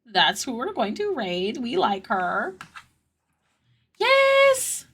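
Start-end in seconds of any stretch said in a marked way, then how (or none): 4.0-4.9 high excited yes. Real, not acted. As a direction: cheer it out. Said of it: "I am excited".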